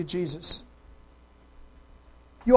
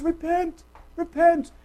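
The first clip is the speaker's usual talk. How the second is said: gentle repentance call; 7 semitones higher, slower than usual. Shown as a demonstration. Preacher shows John's comforting call to repentance, gently. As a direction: plead it kindly, without shouting. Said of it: "It was".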